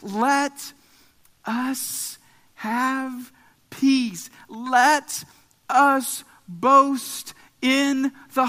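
weighty, deliberate pulpit exhortation; emphatic scripture proclamation. Acted. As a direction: declare each word with long pauses.

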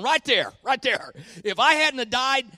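high, loud chest-pounding boast; proud triumphant Jesus. Retold or imitated. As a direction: point and crow with pride. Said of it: "pounding his chest, going".

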